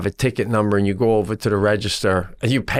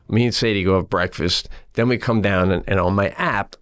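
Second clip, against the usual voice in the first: ordinary talk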